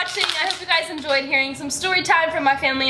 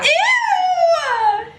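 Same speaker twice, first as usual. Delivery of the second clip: disgusted shriek; 11 semitones higher than usual. Real, not acted. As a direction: squeal in disgust. Feet rubbing on her leg.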